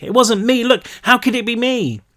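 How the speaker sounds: frantic protest. A narrator's reading. Brick pleading innocence.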